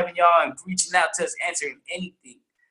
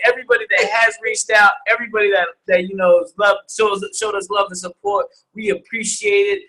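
ordinary talk

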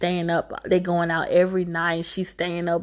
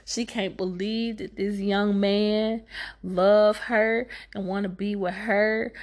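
ordinary delivery

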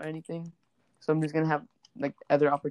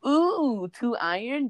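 excited; 8 semitones higher, much slower than usual. Real, not acted. Minecraft find.

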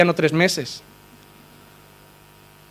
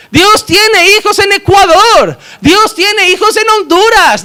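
slow, loud, high-pitched proclamation, each line hammered; fiery preaching; 11 semitones higher, 5 dB louder than usual. Real, not acted. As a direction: preach it full force to the congregation. Preaching at full force.

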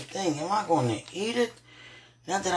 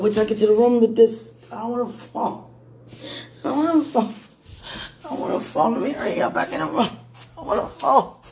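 afraid of falling; 4 semitones higher, 5 dB louder, faster than usual. Real, not acted. Hurrying with food, afraid.

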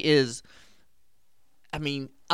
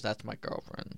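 put-on whispered, proud boyfriend; whispered aside; 4 semitones lower, 13 dB quieter than usual. Peter whispering to strangers in the crowd, whispered. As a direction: whisper it to the guys beside him. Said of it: "he goes".

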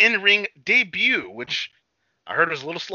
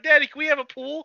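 put-on kid voice; 13 semitones higher, 4 dB louder than usual. Kids asking dad, retold.

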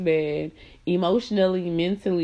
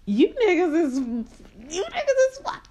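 squealed disbelief; 10 semitones higher than usual. Retold or imitated. Mocking her ex's hypocrisy, high squeal. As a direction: shriek it in mock outrage. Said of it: "And I was like".

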